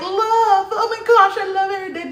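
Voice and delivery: mock sweet lover. Mocking her younger self to the family, syrupy gushing. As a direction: ham up a syrupy love-struck voice. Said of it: "I'm just like".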